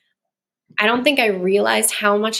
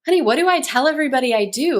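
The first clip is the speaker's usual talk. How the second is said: lightly higher, quick mom voice; mother to young daughter; 6 semitones higher, faster than usual. Retold or imitated. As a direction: re-enact asking a little child for help. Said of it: "talking to my daughter".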